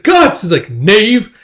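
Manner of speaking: high, loud shouts; acted outcry. Onlookers shout at Guts tripping a guard, outraged. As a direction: cry out in outrage.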